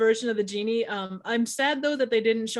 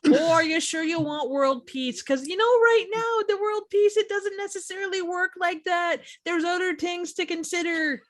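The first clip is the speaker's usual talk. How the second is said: much higher Wisconsin lilt; fussy Midwest genie warning about wishes; northern Wisconsin accent; 7 semitones higher than usual. Retold or imitated. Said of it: "northern Wisconsin accent".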